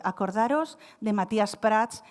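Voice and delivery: ordinary talk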